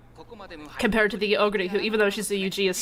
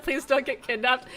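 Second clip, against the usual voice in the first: worried parent; 3 semitones higher than usual. Pretend. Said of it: "Me talking to my child".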